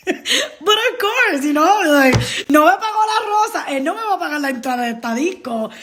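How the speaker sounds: high-pitched, laughing exasperation; laughing complaint. Real, not acted. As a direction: laugh through the griping. Laughs at being unpaid again.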